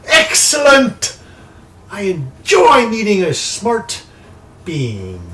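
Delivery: loud, high, drawn-out theatrical glee; slow, piercing villainess exclamation; delighted sorceress. Acted. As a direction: crow with triumph as a scheming enchantress. Sorceress delighted at player's agreement.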